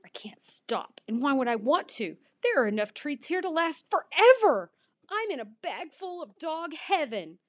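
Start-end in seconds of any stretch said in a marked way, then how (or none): 2.4-7.4 high-pitched, overjoyed; high, elated exclaiming; blissful excitement. A narrator's reading. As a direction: gush like a dog in paradise. Dog gorging, in bliss.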